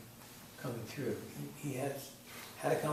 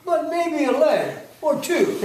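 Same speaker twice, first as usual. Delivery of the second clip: loud, slow, high commercial announcer; 9 semitones higher, 14 dB louder, much slower than usual. Acted. Closing beer commercial tag line, hammed-up loud announcer. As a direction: sell it big like a TV spot. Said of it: "Announcer comes on".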